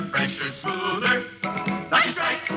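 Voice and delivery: usual speaking voice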